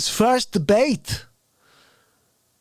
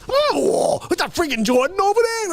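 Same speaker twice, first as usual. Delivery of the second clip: hammed-up outrage; 4 semitones higher than usual. Bragging about a cigar hook shot into coffee, hammed-up outrage. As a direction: overplay the wounded indignation, stretching key words high.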